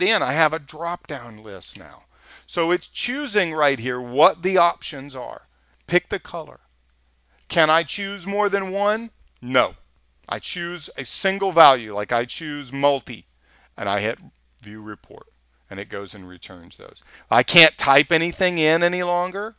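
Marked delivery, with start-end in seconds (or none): none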